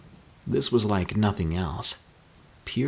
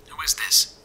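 higher, muffled transmission; radio reply; 6 semitones higher than usual. A narrator's reading. Stranger answers Mika's call, muffled over radio. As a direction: answer through a faint radio. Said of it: "came back through".

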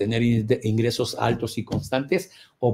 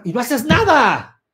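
scolding shout; 10 semitones higher, 8 dB louder than usual. Acted. Scolding paralysis, acted.

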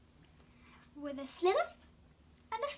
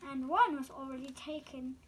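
usual speaking voice

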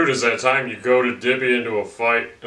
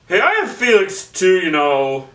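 higher and louder, hurt and whiny; 8 semitones higher, 6 dB louder than usual. Acted. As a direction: complain in a high pouty voice. Whiny character voice complaining.

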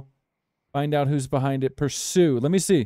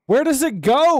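exasperated shout; 8 semitones higher, 4 dB louder than usual. Real, not acted. Searching for lost target.

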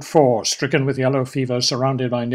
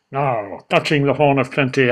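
ordinary delivery

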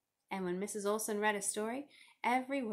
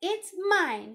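high, loud possessive yell; insistent child's outburst; 10 semitones higher, 9 dB louder than usual. A narrator's reading. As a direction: yell like a child claiming a toy. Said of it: "she yelled".

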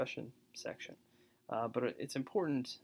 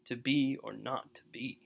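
BE, NOT and BE stressed, the words between weak; iambic stress; 4 dB louder than usual. Shown as a demonstration. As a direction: beat out "to BE or NOT to BE". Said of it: "iambs is the iambic".

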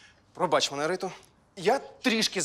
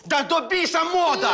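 higher, louder angry outburst; angry shout; 10 semitones higher, 4 dB louder than usual. Acted. Husband yells at his wife over the underwear, angry shout. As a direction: blow up at her about fashion. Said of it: "Не кричи!".

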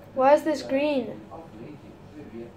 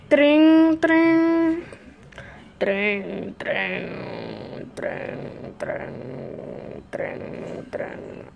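mock phone ring; much slower than usual. Imitating a phone ringing.

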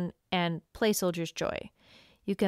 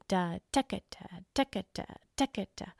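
scat "decca" with weight on the offbeat, fading softer; accent on the offbeat; 3 semitones lower, 8 dB quieter than usual. Shown as a demonstration. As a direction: punch the off-beat syllable of "da, decca". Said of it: "with an accent on the offbeat".

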